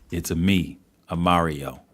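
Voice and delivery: low, understated take on the plumber catchphrase; Mario. Acted. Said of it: "That's not the voice".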